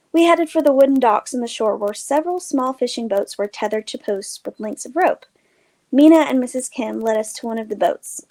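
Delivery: plain reading voice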